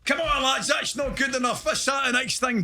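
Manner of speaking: high-pitched, urgent dressing-room shouting; hammed-up rallying of the lads; players' mock team talk. Footballers acting up at half-time for the camera, mock urgent shouts. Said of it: "So we come in half time".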